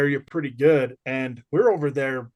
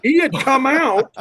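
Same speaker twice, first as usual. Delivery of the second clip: amused amazement; 7 semitones higher, 5 dB louder than usual. Real, not acted. Storyteller reveals the teacher was in on the prank, amused amazement. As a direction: land the big reveal, pitched up and laughing.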